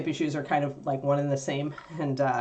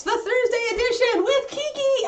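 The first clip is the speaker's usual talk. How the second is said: excited shout. Real, not acted. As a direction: call it out, thrilled, over the dog. Host intro, dog jumps up.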